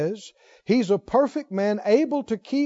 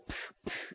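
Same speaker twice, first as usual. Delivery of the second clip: sharp "psh, psh" puffs of air; two quick voiceless swatting sounds; imitated swat noise; 20 dB quieter than usual. Preacher miming a swat at the complainer, imitated noise. Mimes reaching over to swat him.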